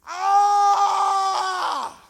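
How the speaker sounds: high, loud mock scream; a loud, high "ah" like on a ride; roller-coaster scream. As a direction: let out a demonstration yell with hands up. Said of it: "tiene que levantar las manos y gritar".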